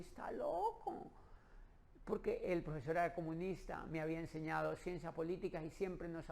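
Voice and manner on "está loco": agudo y lento, incrédulo; suéltalo alto y despacio, sin creerlo. Retold or imitated. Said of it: "y yo dije". ("está loco" dicho agudo, lento y con asombro)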